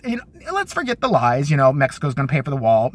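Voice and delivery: ordinary talk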